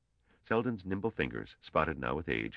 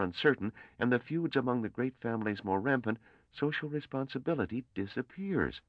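earnest young scholar, voiced much higher; a nervous young mathematician reasoning aloud, pitched up; young Gale voice; 9 semitones higher than usual. A narrator's reading. Young Gale's voice, explaining.

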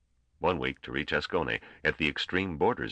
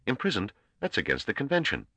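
higher, sharp indignation; indignant; 8 semitones higher than usual. A narrator's reading. Young trader outraged at the imprisonment, mildly indignant. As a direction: bristle at the news, not shouting. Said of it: "Gorm's expression jolted into anger".

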